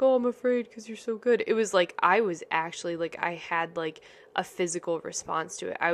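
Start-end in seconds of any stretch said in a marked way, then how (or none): 0.0-1.4 offhand, lightly higher "oh I'm afraid"; breezy mock fear. Host contrasting a flippant fear with her real fear, offhand. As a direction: toss it off as a cute throwaway line. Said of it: "It wasn't just like".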